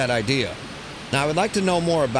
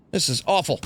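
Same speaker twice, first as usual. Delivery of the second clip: ordinary delivery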